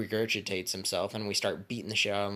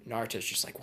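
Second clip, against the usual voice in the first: ordinary talk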